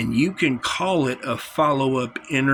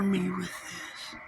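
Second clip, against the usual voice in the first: whisper; 7 semitones higher, 9 dB quieter, slower than usual. Real, not acted. Pleading with viewers mid-rant.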